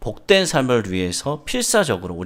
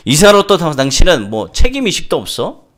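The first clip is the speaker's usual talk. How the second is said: loud, raised-pitch accusation; angry father yelling; 7 semitones higher, 10 dB louder than usual. Acted. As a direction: berate the doctor at high volume. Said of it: "소리를 지는 거예요". (화내며 큰 소리로 몰아붙이듯)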